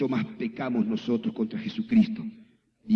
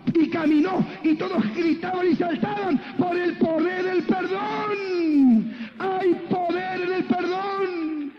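high, ringing revival climax with stretched endings; full-force preaching. Acted. Preacher tells crowd of woman walking, triumphant preaching. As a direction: build to a sustained high cry of triumph.

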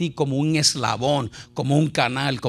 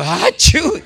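loud, higher sneeze noise; mock sneeze; 6 semitones higher, 7 dB louder than usual. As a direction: sneeze like a horse. Horse sneezes in the joke. (estornudo fingido y fuerte)

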